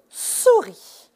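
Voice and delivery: "souris" slowly, each syllable drawn out; syllabes détachées. Shown as a demonstration. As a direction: stretch "souris" so both syllables can be counted. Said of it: "Combien de syllabes est-ce que vous entendez". (« souris » lentement, syllabe par syllabe)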